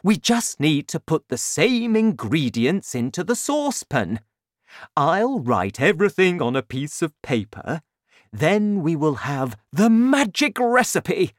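too excited to listen. A narrator's reading. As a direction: lay out the plan with mounting relish. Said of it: "Mister Cranky was too excited".

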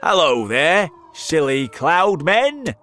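taunting shout. A narrator's reading. Centipede shouts at cloud men, taunting. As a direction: holler a cheeky taunt across the distance. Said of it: "began shouting at the cloud men".

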